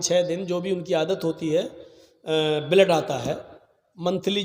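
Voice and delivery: normal talking voice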